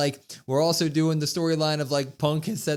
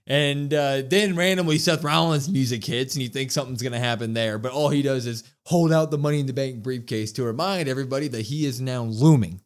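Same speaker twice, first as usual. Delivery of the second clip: usual speaking voice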